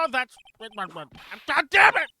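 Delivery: slow furious drawn-out curse; angry outburst. Real, not acted. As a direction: let the frustration explode. Frustrated outburst at game.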